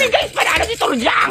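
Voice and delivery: high-pitched jubilant call; thrilled squeal; excited announcement. Acted. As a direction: shout the victory to your friends. Friend announces the win.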